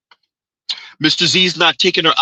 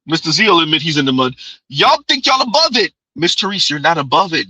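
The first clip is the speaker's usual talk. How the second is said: ordinary delivery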